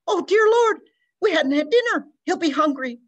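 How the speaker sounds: crying wail, very high and loud, easing down; mother wailing that her lost boy missed dinner, crying. Acted. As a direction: break into a sobbing wail. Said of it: "Missus O'Brien wailed".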